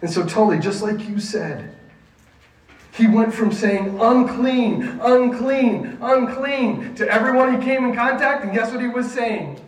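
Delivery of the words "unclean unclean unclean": raised, loud, evenly repeated call; high, insistent imitated cry; repeated warning cry. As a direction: call it out three times like a warning. Quoting leper's old repeated cry.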